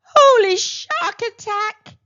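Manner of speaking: loud, high, slow startled yelp; a dentist jolted by a shark arrival. Acted. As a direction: yelp it in a panic. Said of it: "yelped Doctor Dribble".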